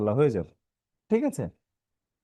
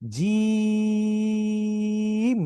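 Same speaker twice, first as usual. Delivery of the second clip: জিম held very long on a steady, higher note; long stretch; 6 semitones higher, much slower than usual. Shown as a demonstration. Teacher models the letter's name for pupils, long-drawn জিম. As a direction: draw out the ee of জিম before closing on m. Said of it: "একটু বেশি টান".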